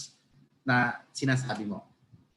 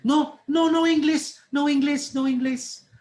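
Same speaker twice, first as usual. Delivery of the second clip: high, loud put-on broken-English accent; faked poor-English accent; 11 semitones higher, 6 dB louder than usual. Tired traveller dodging a chat with Japanese passenger, faked poor-English accent. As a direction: fend them off in a fake foreign accent, high and insistent. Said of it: "I was supposed to not speak good English".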